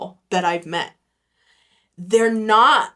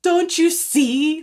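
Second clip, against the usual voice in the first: high, slow, loud plea; pleading; 9 semitones higher, 5 dB louder, slower than usual. Pretend. Imagined plea to churchgoers, high and urgent. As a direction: drag it out, begging them to see. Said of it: "shake them and be like".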